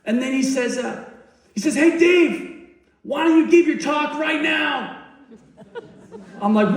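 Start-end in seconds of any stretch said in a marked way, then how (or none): 1.8-5.0 very high, hollered mock suggestion; hammed-up excited shout; imitated yelling. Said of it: "So he's yelling".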